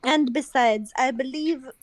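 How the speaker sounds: put-on measured, refined French accent. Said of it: "poor French accent".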